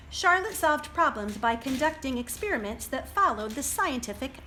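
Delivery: steady picture book read aloud; reading to children. A narrator's reading. Librarian reading to children, steady storybook voice. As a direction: read it out for the little ones.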